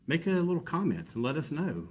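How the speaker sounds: usual speaking voice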